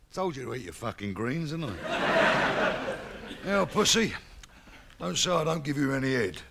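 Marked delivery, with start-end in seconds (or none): none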